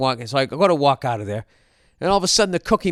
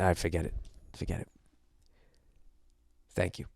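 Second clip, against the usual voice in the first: low, much softer mumbled surrender; acted resigned defeat; 6 semitones lower, 11 dB quieter, faster than usual. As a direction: give up, fading to almost nothing. Gives up on buying, defeated.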